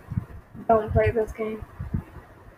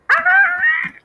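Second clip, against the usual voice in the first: sudden high shriek of fright; startled scream; 6 dB louder than usual. Real, not acted. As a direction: scream at the jump scare. Jump scare in horror game.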